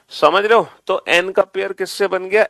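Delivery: ordinary talk, nothing marked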